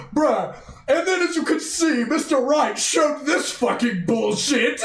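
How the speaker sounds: high, laughing mock prosecutor voice; mocking Edgeworth. Streamer mocks Edgeworth presenting junk evidence, high laughing mock voice. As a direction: send up a smug lawyer presenting junk evidence. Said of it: "he's gonna be like".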